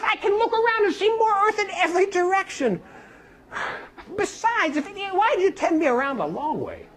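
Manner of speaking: put-on high, bewildered young man; young man's protest. Young man objecting to the wise man, high and bewildered. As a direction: object with a laughing disbelief.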